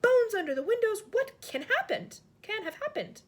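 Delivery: high, loud horrified outburst; horrified cry. Acted. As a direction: cry out in horror. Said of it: "the famous voice cried out".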